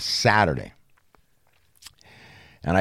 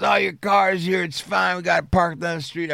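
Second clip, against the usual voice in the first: lightly voiced buddy, pitched higher; imitating the friend; 8 semitones higher than usual. Friend calls to say the car is parked, lightly voiced higher. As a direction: play the casual friend reporting back, a bit higher.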